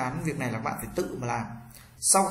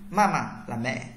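usual speaking voice

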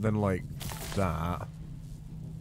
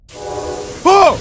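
loud high startled "Oh!"; startled yelp; 14 semitones higher, 11 dB louder than usual. Real, not acted. Player jolted by the powering machine, startled yelp. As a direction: jump at a sudden fright.

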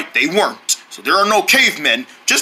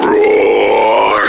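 low breathy dinosaur roar; monster roar; 13 semitones lower, 3 dB louder than usual. Acted. Dinosaur roaring at the deer, low and loud. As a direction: roar like a giant beast.

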